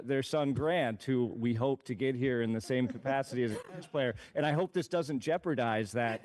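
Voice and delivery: chuckling while joking; laughing. Real, not acted. Teasing joke about hiring tennis parents.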